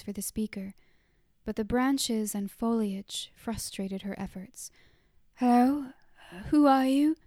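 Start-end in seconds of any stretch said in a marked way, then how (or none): 5.4-7.2 louder, higher call into the trees. A narrator's reading. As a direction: call out to someone you can't see. Calling to unseen speaker.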